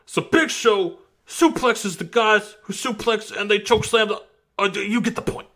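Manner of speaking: imitating Taz. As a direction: do a goofy imitation of the commentator. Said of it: "Taz says".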